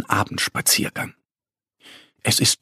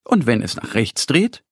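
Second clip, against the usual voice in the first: ordinary delivery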